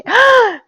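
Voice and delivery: loud, high gasp; shocked gasp. Real, not acted. Gamer sees her Sim is sleepy, shocked gasp. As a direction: gasp in disbelief.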